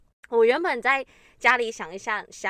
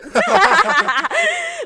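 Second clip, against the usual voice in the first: high, loud burst of laughter; 11 dB louder than usual. Real, not acted. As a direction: break into a big laugh. Laughs at own joke. (大笑)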